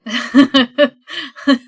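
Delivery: big burst of real laughter; loud laugh. Real, not acted. Group laughs at unanimous yes.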